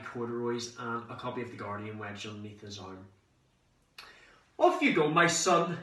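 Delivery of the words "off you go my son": high, loud fatherly proclamation; yelled send-off. Acted. Dad seeing his son off from the front step, yelled. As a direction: call it out like a pompous sergeant major. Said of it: "he yelled".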